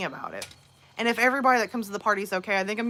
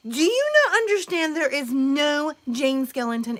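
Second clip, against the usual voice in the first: put-on sharp, louder, higher kid's protest; indignant child; 5 semitones higher, 4 dB louder than usual. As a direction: argue like an offended kid. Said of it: "Olivia's like".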